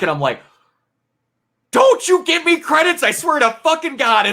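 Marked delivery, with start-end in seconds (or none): 1.7-4.3 high, loud protest, played for laughs; exaggerated furious outburst; mock outraged shout. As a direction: yell at the screen in hammed-up outrage. Acts out outrage at credits appearing.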